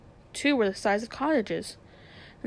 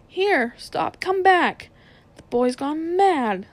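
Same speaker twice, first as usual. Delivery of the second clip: calling after them; 6 semitones higher, 5 dB louder, slower than usual. Acted. Uncle calling after the children.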